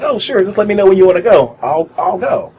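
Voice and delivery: bright, quick, high-pitched yes; eager high voice. Retold or imitated. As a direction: jump at the invitation, eagerly. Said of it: "I'm like".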